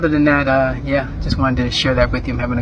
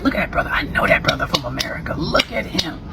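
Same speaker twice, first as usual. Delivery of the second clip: whispered aside, voice nearly gone; hushed aside; 5 dB quieter than usual. Retold or imitated. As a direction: murmur it so he can't hear. Retold private aside about stranger.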